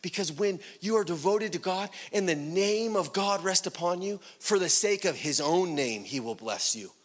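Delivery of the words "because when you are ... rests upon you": ordinary talk, nothing marked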